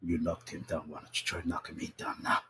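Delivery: hushed, unvoiced threat; quiet menace. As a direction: whisper it like a provoking fighter.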